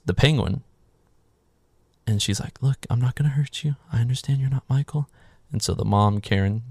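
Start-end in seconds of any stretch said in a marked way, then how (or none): 2.6-5.1 higher, gentle coaxing voice; mock reassuring. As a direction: coax someone frightened, lightly acted. Voicing woman reassuring the frightened patient.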